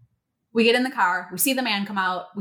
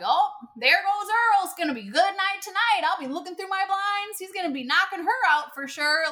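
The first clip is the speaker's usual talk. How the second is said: gossipy neighbor; 8 semitones higher than usual. Retold or imitated. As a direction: mimic the nosy neighbor, pitched up. Neighbor lady gossiping about Earl.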